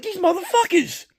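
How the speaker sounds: high, strained exasperation; high pitched fed-up swear; exasperated curse. Retold or imitated. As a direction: spit it out at the TV in disbelief. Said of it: "I'm like".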